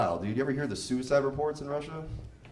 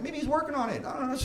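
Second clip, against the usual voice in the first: mock innocent; 11 semitones higher, faster than usual. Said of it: "I'm like".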